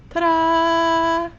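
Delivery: high, sing-song ta-da; ta-da reveal. Real, not acted. Crafter shows viewers the finished squirrel with a bright high ta-da. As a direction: present it like a magic trick.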